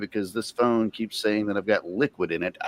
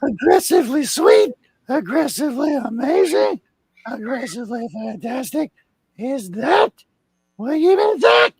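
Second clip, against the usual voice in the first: aggressively sweet; 5 dB louder than usual. Acted.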